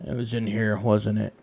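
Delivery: ordinary talk, nothing marked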